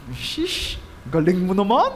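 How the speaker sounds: mock impressed, drawn out; sarcastic jab. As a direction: tease him for bragging. Pastor mocks the boast.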